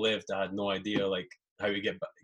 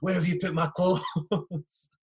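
shouting, laughing; 8 semitones higher, much slower than usual. Retold or imitated. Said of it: "We're shouting at the girl".

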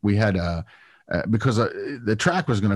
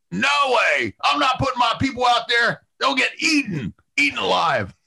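alarmed refusal; 12 semitones higher than usual. Retold or imitated. League boss refusing alligators.

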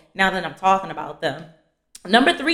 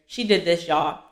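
usual speaking voice

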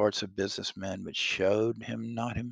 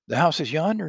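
exclaiming; 7 semitones higher, 7 dB louder than usual. Acted. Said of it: "Scrooge exclaimed".

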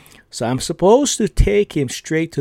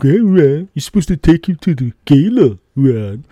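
imitating a boss, louder and pitched up; mock boss yell; 2 semitones higher, 5 dB louder than usual. Boss calling out Ron about the gala, mock loud. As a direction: call it out like a mildly annoyed boss.

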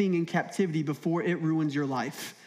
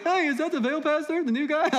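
higher, gossiping neighbor voice; nosy neighbor; 8 semitones higher than usual. Retold or imitated. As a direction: play the neighbor spotting the pastor.

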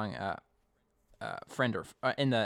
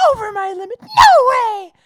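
much higher, loud shrieking outburst; flipping out; 19 dB louder than usual. Retold or imitated. Connie storming out of the palace, shrieking loud and high. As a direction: shriek it out like a teen in a tantrum. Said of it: "Connie's flipping out. She's like".

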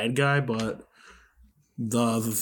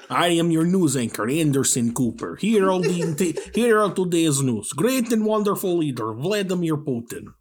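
loud, raised announcer with mock Russian accent; Russian news anchor; 6 semitones higher, 6 dB louder than usual. Impression of CNN in five years, Russian-accented anchor. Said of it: "my impression of C N N in five years".

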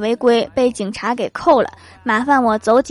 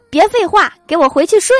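loud, higher mock-stern dad; dad barking orders; 7 semitones higher, 6 dB louder than usual. Dad orders his son back to bed, loud mock command. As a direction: bark it like a bossy father.

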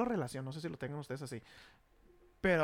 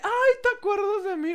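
high, sugary mock voice of the ex; mock sweet ex; 11 semitones higher, 6 dB louder than usual. Teller imitates his ex greeting him, in a high mock-sweet voice. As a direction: coo it like a fake-sweet ex. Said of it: "Y me dice".

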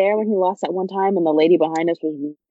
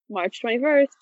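normal talking voice

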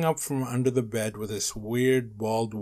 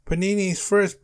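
high, loud demand; yelling; 9 semitones higher, 6 dB louder than usual. A narrator's reading. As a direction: yell it like insistent hungry kids. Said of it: "Baby Giraffe and Melvin yelled".

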